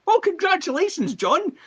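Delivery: teacher gushing at John in a high mock voice; mock teacher praise. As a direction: gush with exaggerated delight.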